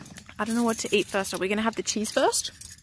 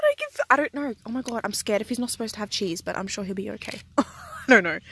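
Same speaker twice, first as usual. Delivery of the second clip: ordinary delivery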